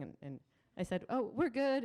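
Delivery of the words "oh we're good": much higher, breezy cover-up; imitated too-breezy reassurance; mock casual. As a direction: act as if all is fine, pitched high. Covering up scare on conference call.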